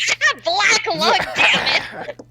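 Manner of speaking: high, loud croaky in-character voice; hoarse high pitched rasp; coughing character. Acted.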